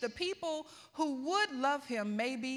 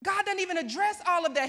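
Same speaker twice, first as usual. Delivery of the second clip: usual speaking voice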